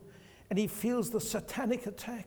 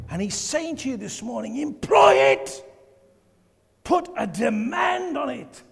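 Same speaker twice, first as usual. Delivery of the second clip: fervent preaching; 3 semitones higher, 7 dB louder than usual. Real, not acted. Preaching at full force.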